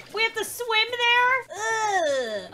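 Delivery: wailing dismay. Real, not acted. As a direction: protest like it's a tragedy. Dismay at game task.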